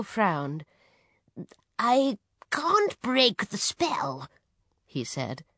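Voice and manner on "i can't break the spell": high, slow wizard voice; old wizard. A narrator's reading. Wizard to the desperate dad, old wizard voice. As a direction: draw it out as a frowning wizard.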